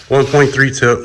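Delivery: normal talking voice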